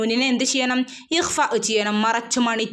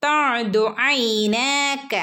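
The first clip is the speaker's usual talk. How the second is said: very slow, chanted tajweed reading; slow Quran recitation; much slower than usual. Shown as a demonstration. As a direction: draw out തഅദു ഐനാക in recitation. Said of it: "രണ്ടനക്കം നീട്ടി കൊടുക്കുക". (പതുക്കെ ഖുർആൻ പാരായണം)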